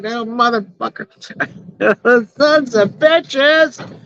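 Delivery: high, slow, hammed-up cursing; mock exasperated cursing. Real, not acted. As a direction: curse the bugs half-laughing. Cursing the bugs, laughing.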